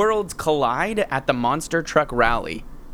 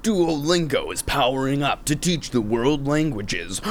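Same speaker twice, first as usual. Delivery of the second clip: anime announcer. Pretend. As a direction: hype the next episode like Dragon Ball Z. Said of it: "that started as Dragon Ball Z".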